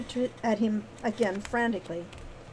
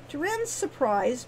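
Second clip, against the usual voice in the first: ordinary delivery, nothing marked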